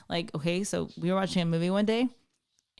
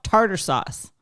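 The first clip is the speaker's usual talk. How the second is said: loud mock outburst, no real scream; retold scream; 2 semitones higher, 6 dB louder, slower than usual. Parent retelling a son's forbidden word, mock yell. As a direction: blurt it out louder, imitating a furious kid. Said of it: "he just screams out".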